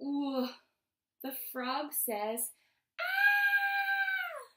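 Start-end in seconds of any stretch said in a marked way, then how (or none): 3.0-4.5 high, loud, drawn-out scream. Acted. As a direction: scream as the terrified frog. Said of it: "He screams".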